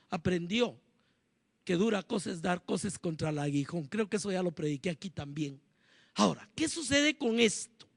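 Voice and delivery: ordinary talk, nothing marked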